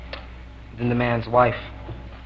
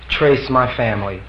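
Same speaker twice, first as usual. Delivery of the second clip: usual speaking voice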